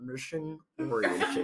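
slow, halting, lightly voiced Holmes; Elizabeth Holmes impression. Imitating Elizabeth Holmes answering the one word interview question. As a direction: hesitate then answer with a touch of her earnest deadpan. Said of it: "I'll just turn to him and be like".

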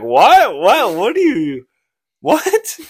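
loud, high repeated "what" that cracks into a laugh; incredulous shouting. Retold or imitated. Mom tells him to take her daughter to his room, he shouts in disbelief. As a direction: yell it in total disbelief, laughing by the end. Retold quote, mom's outrageous proposal.

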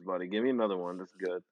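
ordinary talk

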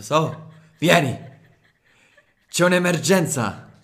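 loud, high alarmed call; urgent shout. Retold or imitated. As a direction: call out in a panic. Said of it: "ho detto".

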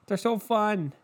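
a hint of a giddy TV anchor; mocking SportsCenter. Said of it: "SportsCenter is like".

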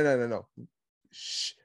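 ordinary talk, nothing marked

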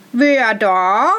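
loud drawn-out challenge; called challenge. A narrator's reading. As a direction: call out to a stranger in the dark. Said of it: "rief er".

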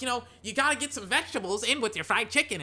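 a hint of a nagging mom at the dinner table; imitating parents. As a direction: mimic a parent scolding a kid about vegetables. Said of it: "But they're like".

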